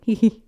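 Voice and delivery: high, loud little giggle. A narrator's reading. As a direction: giggle like a pleased child. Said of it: "pointing and giggling".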